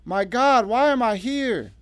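high, loud anguished wail; sobbing. A narrator's reading. Revived Emily begging to know why she's here, sobbing. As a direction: wail the question, drawing out the end. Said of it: "sobbing so hard her chest".

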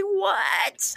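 high-pitched shout of disbelief; re-enacted shocked outburst; astonished. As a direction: shoot the pitch way up, incredulous. Reacting to unexplained voice on tape.

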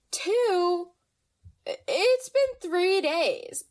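high, drawn-out mock exasperation; exasperated disbelief. Scolding a friend's too-hasty romance, exasperated disbelief.

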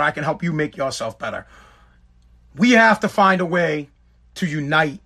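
normal talking voice

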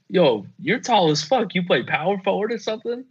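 high, fast mock hustler sizing up the dad; street hustler. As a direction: tease the tall dad like a street seller. Hustlers voiced addressing the dad.

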